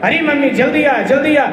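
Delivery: high-pitched urgent summons; crying out. Acted. Bride calls mother-in-law to see the butter, crying out. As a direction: call mummy over excitedly. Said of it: "इतने जोर से चिल्लाई".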